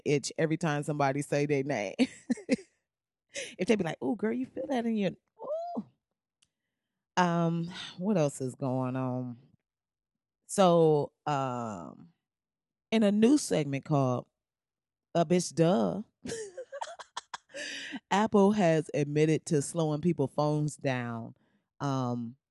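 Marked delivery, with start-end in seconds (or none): 4.0-5.8 put-on gossipy girlfriend. Imitating gossiping women. 16.2-18.1 high laugh. Real, not acted. Laughs after segment title.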